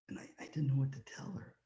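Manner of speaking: a moved speaker's soft whisper; real hushed whisper. Real, not acted. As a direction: breathe it out, lost for words. Choked up after the girl's story.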